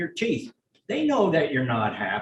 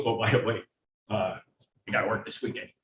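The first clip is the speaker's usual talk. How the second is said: lower, offhand boss dropping bad news; low mock boss voice; 7 semitones lower, 5 dB quieter, faster than usual. Boss telling an employee he must work the weekend, low and offhand.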